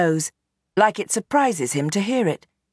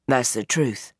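lower, weary father pleading his case; old gangster Bernie; 5 semitones lower than usual. Acted. Gangster father explains himself to his daughter, low and weary. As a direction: sound like a tired old crime boss.

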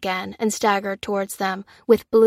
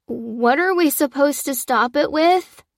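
higher, frightened question; scared boy asking what to fight with; trembling voice; 5 semitones higher than usual. A narrator's reading. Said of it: "trembled Jack".

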